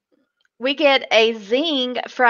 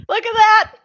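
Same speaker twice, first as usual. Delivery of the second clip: gloating; 11 semitones higher, 5 dB louder than usual. Retold or imitated. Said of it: "I was like".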